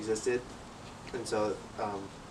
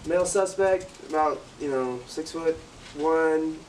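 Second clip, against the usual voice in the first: radio alert; 4 semitones higher, slower than usual. Retold or imitated. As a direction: bark the suspect's description over the walkie. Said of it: "what I do is like".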